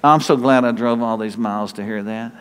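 low, deadpan mock gratitude; low sarcastic aside; dry sarcasm. Dry reply to unwelcome thanks.

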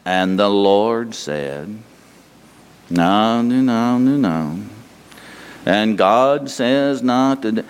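low, slow droning preacher voice; a lightly voiced boring sermon, dragging; mocking a dull preacher. Mimicking a monotone guest preacher, slow and low. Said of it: "Somebody else is bringing messages".

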